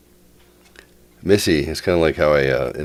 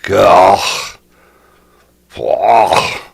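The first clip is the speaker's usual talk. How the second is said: mock anguished groans; 5 semitones higher, 10 dB louder than usual. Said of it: "I was like".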